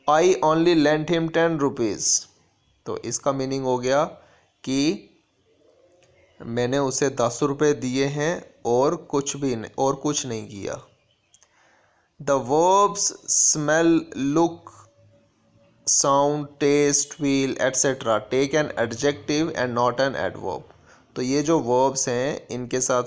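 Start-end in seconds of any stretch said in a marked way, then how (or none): 0.1-2.1 slow, deliberate reading of the example sentence. Shown as a demonstration. Teacher reading an example sentence to the class, slow and clear. 12.2-20.6 very slow, measured reading of a grammar rule. Shown as a demonstration. Teacher dictating a grammar rule to students, very slow.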